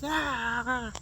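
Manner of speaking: short imitated squawk; mock hen squawk. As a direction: bark out a little hen cry.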